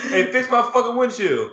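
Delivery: high-pitched pretend outrage; mock demand. Car owner demanding windshield repair, mock outrage.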